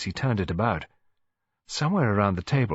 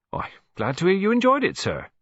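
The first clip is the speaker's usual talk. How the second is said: very high, louder stately cook; gracious; 12 semitones higher, 4 dB louder than usual. A narrator's reading. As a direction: cook accepting praise grandly. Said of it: "she said graciously".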